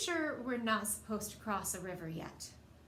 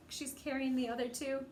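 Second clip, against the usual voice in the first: usual speaking voice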